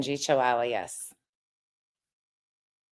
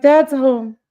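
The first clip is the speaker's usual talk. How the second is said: higher, louder excited dog voice; mock dog voice, pitched up and loud; voicing the dog; 9 semitones higher, 9 dB louder than usual. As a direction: speak for the happy dog. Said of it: "He's like".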